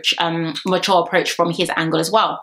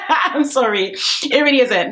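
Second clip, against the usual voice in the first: laughing; 5 semitones higher than usual. Real, not acted. Laughing apology.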